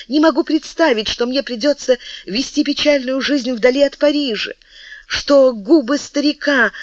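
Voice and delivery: high, anguished pleading; desperate plea. Acted. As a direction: beg him not to let her go. Мария в отчаянии умоляет. (отчаянная мольба, высоким голосом)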